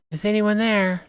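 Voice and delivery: high timid voice at the door; high pleading woman. Acted. Voicing a stranger at the door.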